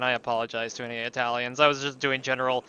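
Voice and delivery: ordinary delivery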